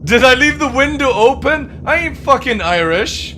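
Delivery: loud indignant yell; yelling at the screen; shouting. Real, not acted. Streamer yelling at game music.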